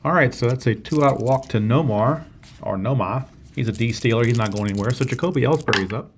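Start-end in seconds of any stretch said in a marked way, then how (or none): 2.8-3.3 "Noma" with the r dropped, New England style; rough New England accent. Acted. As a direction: lightly attempt a New England accent, ending "Noma" on an open ah. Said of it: "not very good with the New England accent".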